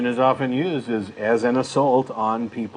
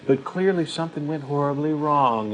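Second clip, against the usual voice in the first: condescending rabbi; 3 semitones higher than usual. Retold or imitated. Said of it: "how pompous and condescending".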